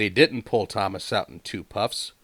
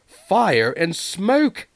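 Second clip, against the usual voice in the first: dismayed exclamation; 11 semitones higher, 6 dB louder, much slower than usual. A narrator's reading. Thomas voiced in dismay.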